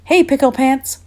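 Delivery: high, loud motherly holler. A narrator's reading. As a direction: call out sharply to a child running by. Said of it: "hollered Mama".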